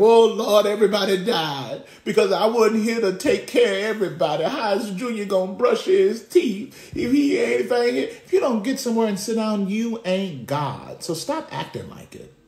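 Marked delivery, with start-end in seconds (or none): 0.0-8.1 hammed-up wail of despair, higher pitched; mock panic. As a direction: lament like a self-important martyr. Mocks imagined panic after day off.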